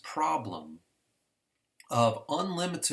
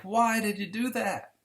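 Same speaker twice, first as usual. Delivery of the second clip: exasperated God; 10 semitones higher, 4 dB louder than usual. Retold or imitated. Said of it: "And God's like".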